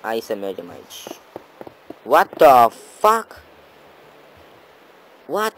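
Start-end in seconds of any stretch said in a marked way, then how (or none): 2.0-5.5 loud, high, drawn-out disbelief; slow high-pitched yell; stunned outburst. Real, not acted. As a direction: stretch out a shout of amazement. Amazed at the loot.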